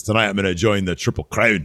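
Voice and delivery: bad British accent; clumsy put-on British accent; British Bulldog. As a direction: badly imitate a British wrestler. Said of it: "That was not a good Bulldog accent".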